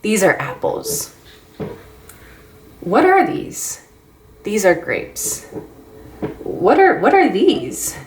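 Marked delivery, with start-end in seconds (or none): 2.8-3.8 high, exaggerated questioning tone; questioning intonation. Shown as a demonstration. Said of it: "use that intonation in your voice". 6.4-8.0 high, exaggerated questioning tone; questioning intonation. Shown as a demonstration. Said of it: "that change of voice so that they know".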